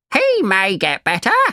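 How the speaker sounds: a hint of an old crow. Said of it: "said the crow".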